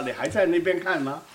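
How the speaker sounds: usual speaking voice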